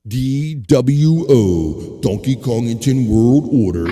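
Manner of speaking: slow, loud, drawn-out announcer call; big stretched-out hype call; mock announcer. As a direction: proclaim it like a ring announcer. Announcing a mock faction name.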